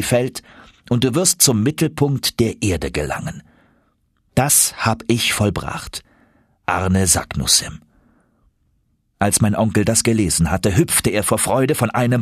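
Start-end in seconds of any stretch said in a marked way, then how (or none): 4.4-7.8 slow, weighty reading; solemn signature. Acted. Professor reading the old signature, slow solemn. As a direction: intone it like an ancient seal.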